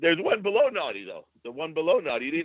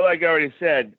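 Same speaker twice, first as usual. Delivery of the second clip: ordinary talk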